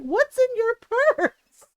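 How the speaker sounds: high, drawn-out retold wail; pained. Cousin hit by the purse to his aunt, wailing. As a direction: imitate a hurt, baffled nephew. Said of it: "he's clutching his stomach and his arm".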